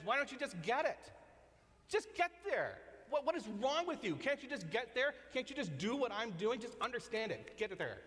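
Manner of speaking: impatient scolding lord. Pretend. Harsh lord to disciples who don't understand, exasperated and high. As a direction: scold them like a fed-up boss who can't believe they don't get it. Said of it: "He's not the kind of Lord who says".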